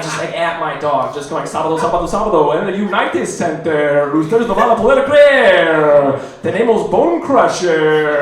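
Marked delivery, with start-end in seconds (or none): 1.4-8.2 monster truck ad voice. Retold or imitated. Announcer hyping a monster truck show at his dog. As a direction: sell Bone Crusher like a rally commercial. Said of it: "monster truck rally commercials".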